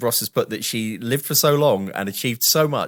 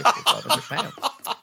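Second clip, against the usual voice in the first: laughing; 14 semitones higher, much slower than usual. Real, not acted. Laughing at small sum.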